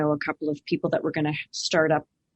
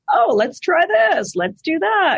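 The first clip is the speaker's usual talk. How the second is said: high, bright eager self-quote; lightly mocking her own excitement; imitating her own eagerness; 13 semitones higher, 6 dB louder than usual. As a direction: pitch way up, slow and keen, like pitching a wild idea. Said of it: "and I'm like".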